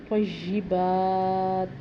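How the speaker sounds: طیبات drawn out with a stop on the end; stopped طیبات. Shown as a demonstration. Teacher showing the stop form of طیبات. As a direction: say طیبات slowly, ending still. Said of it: "اس کو طیبات تو نہیں پڑھیں گے".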